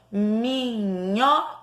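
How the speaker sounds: "Minhoca" stretched slowly, each syllable held; drawn-out syllables. Shown as a demonstration. Demonstrates filling in the syllable.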